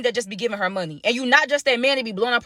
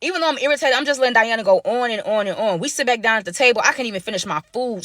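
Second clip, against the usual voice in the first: ordinary talk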